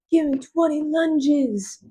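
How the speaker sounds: high, drawn-out order in a put-on voice; mock trainer's order. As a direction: mimic a command, stretching every word high. Voicing Cassian ordering exercise.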